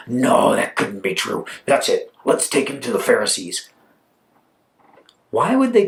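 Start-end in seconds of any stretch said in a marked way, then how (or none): 0.1-3.7 low-pitched dismissive verdict; stern disbelief. Acted. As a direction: shut it down with a low voice. Doubters dismiss the healed man.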